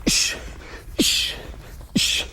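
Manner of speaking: short hissed breath with each strike; sharp exhale hiss. Shown as a demonstration. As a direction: hiss the air out on every kick.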